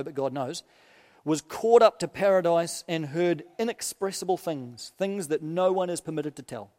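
measured, solemn reading of Paul's letter; preacher reading scripture aloud. Acted. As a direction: read it out to the congregation with weight. Minister reads Paul's letter aloud.

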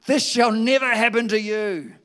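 rebuking. A narrator's reading. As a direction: rebuke him forcefully. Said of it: "began to rebuke him".

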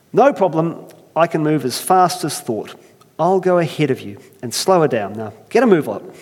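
confident Irish brogue; brisk Irish. Acted. Earthmaker hurrying first man in an Irish accent. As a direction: urge him on cheerfully. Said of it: "I can't help but try it".

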